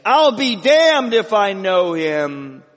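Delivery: high, loud, drawn-out swearing; cried-out oath. Acted. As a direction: cry it out slowly, stretching the words. Acting Peter mid-curse before crow.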